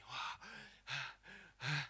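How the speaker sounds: spent, mock gasps for breath; exhausted panting. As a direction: pant as if drained after the ride. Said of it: "Saya keringet basah, saya tuh gini".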